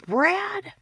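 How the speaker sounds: high, loud call of a name; sharp retold yell; shouted name. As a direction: yell the name across the room. Retold shouting through door.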